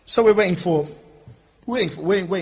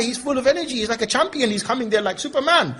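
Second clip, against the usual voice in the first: ordinary talk, nothing marked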